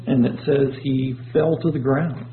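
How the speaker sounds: usual speaking voice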